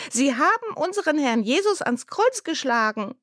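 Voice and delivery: mildly voiced crying child, high and pleading; tearful. A narrator's reading. Girl in class insists, a mild tearful plea. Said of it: "weinte Natalie".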